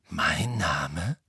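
puzzled echo of the words; lightly voiced surprise. A narrator's reading. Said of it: "sagte Harry verdutzt".

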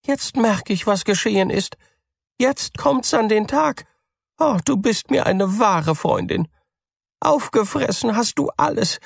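very high, rising accusation; the mouse sees through it all; accusing mouse. A narrator's reading. Mouse to cat, very high and accusing. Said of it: "sagte die Maus".

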